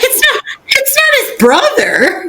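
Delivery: high, squeaky words through laughter; laughing. Retold or imitated. Retelling the guessing game's silly answer, laughing high squeal. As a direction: giggle through a high-pitched denial.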